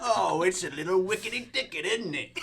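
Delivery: high, hammed-up British accent; a cheeky mock British lilt. Jokey British accent bit.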